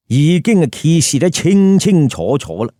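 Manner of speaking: usual speaking voice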